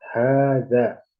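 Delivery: hadza with the first a held long, haadza; long vowel hadza. Shown as a demonstration. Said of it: "dibacanya panjang".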